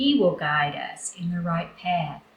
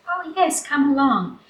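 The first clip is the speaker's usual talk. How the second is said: high, bright yes, come along; eager welcome; 7 semitones higher than usual. Acted. Henny Penny inviting Goosey Lucy, high eager welcome. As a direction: welcome a friend gladly. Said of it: "And Henny Penny said".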